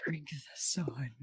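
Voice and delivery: hushed whispered slogan; whispering. Acted. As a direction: breathe the slogan softly.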